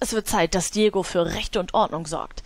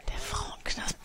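hushed whisper of "Der Frauenknast"; 11 dB quieter, slower than usual. Acted. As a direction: breathe "Der Frauenknast" secretively. Wiederholt flüsternd. ("Der Frauenknast" geflüstert)

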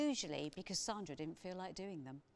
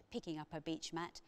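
ordinary delivery

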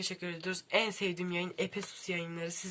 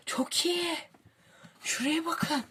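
excited; 10 semitones higher, slower than usual. Real, not acted. Showing the book's details.

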